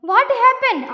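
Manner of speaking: high, loud storybook question; startled question. Acted. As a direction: voice the crocodile's startled concern. Crocodile asks his crying wife. (ഉയർന്ന സ്വരത്തിൽ പകച്ച ചോദ്യം)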